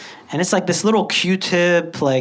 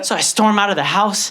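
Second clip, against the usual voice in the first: normal talking voice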